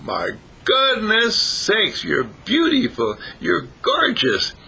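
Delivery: high, slow, awestruck exclamation; acted amazement. Rabbit sees butterfly's wings open, amazed drawn-out cry.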